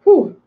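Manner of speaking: letting off steam with a high whew; high relieved whew. Real, not acted. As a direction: blow it out after a rant. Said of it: "a little rant there".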